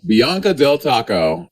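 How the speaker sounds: loud, drawn-out announcement; big, booming playful call; playful shout. Real, not acted. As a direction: belt the nickname out slowly. Playful nickname called out.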